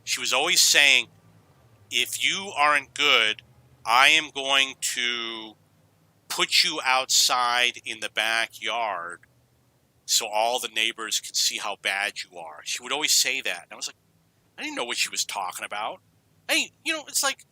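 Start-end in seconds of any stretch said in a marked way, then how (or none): 1.9-12.6 slow, measured warning from a mother; retold threat. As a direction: spell out the threat slowly, phrase by phrase. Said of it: "My mother was always threatening".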